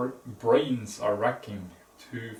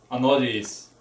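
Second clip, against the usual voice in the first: ordinary delivery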